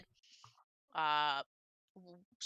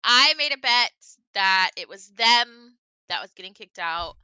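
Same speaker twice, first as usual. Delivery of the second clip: excited; 11 dB louder than usual. Real, not acted. Gleeful player brag after laughing.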